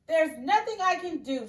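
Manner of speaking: loud, high shout from the king. Acted. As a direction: snap it out loud. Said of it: "shouted the king".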